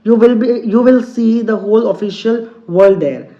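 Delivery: slow, deliberate English reading; teacher reading a story to students, very slow; slow read-aloud. Acted. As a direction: read each word out slowly for the class. Teacher reads story text aloud.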